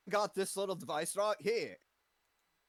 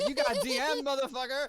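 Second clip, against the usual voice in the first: high, loud gleeful yell; laughing taunt; 8 semitones higher, 5 dB louder than usual. Real, not acted. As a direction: shout it out mid-laugh.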